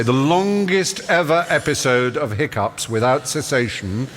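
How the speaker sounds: slow, deliberate know-all recitation; ponderous record-book recital, long pauses; reciting memorized records. Acted. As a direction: drag out every fact with relish. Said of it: "So I was able to say".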